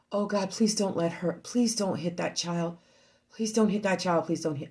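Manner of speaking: low, soft muttered prayer; hushed pleading. Retold or imitated. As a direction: plead under your breath, kept low. Silently pleading in the sauna.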